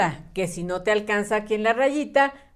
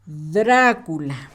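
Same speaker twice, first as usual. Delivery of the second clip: "Drácula" lento, con DRA muy alargado; acento en la primera A; 5 semitones lower, much slower than usual. Shown as a demonstration. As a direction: carga la voz en la primera sílaba de "Drácula". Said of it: "Dra con acento en la primera A". ("Drácula" dictado despacio, acento inicial)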